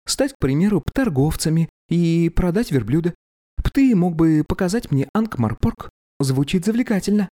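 put-on high, eager girl's voice; young woman voice. A narrator's reading. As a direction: lift it into a dreamy girl. Young girl voiced by narrator.

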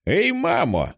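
put-on high boy's voice calling out; child calling. A narrator's reading. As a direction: call for mom like a little boy. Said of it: "кличе Бастер".

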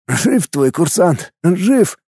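slow, relieved reassurance; wry, drawn-out relief; wry chuckle. A narrator's reading. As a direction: say it with a relieved hum. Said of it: "хмыкнул в ответ медик".

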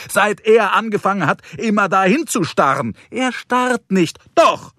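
high-pitched, indignant back-and-forth; heated bickering. Acted. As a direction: squabble with rising exasperation, pitched well up. Heated couple's quarrel in a story.

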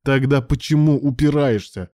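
loud, high demand; furious yell. A narrator's reading. Ilya yells at resisting Alan, furious. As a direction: yell the question in anger. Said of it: "проорал Илья в гневе".

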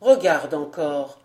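low, coaxing, falsely sweet voice; wheedling. A narrator's reading. Vaunois urging the child to look again, wheedling. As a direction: coax the child, pitched low and firm. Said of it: "dit-il d'une voix pateline".

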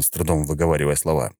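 ordinary delivery

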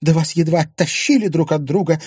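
wife indignantly correcting her husband. Acted. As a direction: scoff at his understatement, outraged. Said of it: "возмутилась Виктория".

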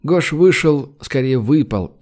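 ordinary delivery

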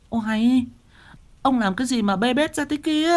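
high-pitched fussing wife voice; startled wife. Acted. Wife finding her husband sprawled on the floor. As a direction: scold him in surprise. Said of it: "wife scolding husband on floor".

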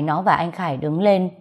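plain reading voice

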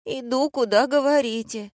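put-on high girlish whimpered protest; whining. A narrator's reading. Girl protesting she follows orders, whimpering. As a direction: plead in a high whiny voice. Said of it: "захныкала девушка".

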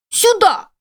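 very high, croaking crow cry; cawing crow. A narrator's reading. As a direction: caw it like a crow. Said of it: "каркнул ворон".

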